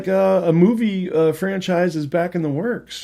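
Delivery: loud, high, eager announcing; excited announcement. Real, not acted. Host telling friend about a returning franchise, loud and eager. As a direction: burst out with big news.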